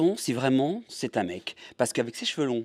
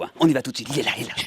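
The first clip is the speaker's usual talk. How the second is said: ordinary delivery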